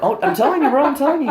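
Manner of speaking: a hammed-up heated bro; mock heated rant. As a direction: mimic yourself losing it, high. Mimicking himself yelling, heated.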